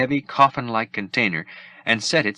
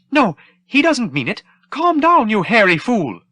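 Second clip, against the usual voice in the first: very high, loud pleading robot voice; begging droid; 7 dB louder than usual. Acted. The droid begs the troopers not to hit him, high and frantic. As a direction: beg like a fussy droid afraid of blows.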